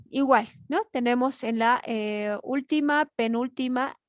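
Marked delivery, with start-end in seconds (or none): none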